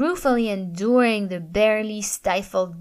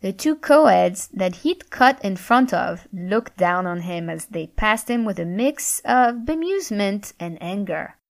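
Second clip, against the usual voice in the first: ordinary delivery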